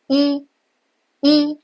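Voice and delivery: 一 with lips spread wide to the sides; 一 said the Chinese way, lips pulled wide. Shown as a demonstration. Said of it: "你的嘴唇是往两边咧开来的".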